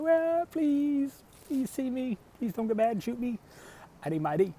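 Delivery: mock pleading. Driver begging the cop from his car, high mock pleading. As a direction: whimper a desperate plea not to be shot. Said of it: "Waving my hand out the window like".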